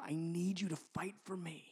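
put-on soft whisper to his kids; whispered plea. Father asking his kids for help, whispered. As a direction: ask it under your breath.

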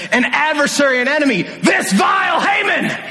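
high shouted accusation; queen denouncing Haman to the king, shouting; accusing shout. Retold or imitated. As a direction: point the finger and shout it out. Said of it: "Esther says".

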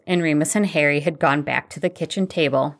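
ordinary delivery

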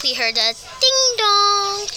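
ordinary delivery

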